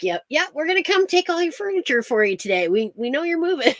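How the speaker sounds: high, quick mock caller voice; prank caller. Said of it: "They'd call people up and they'd say".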